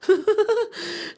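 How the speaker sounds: high bursting laugh at himself. Real, not acted. Laughs at own snoring remark.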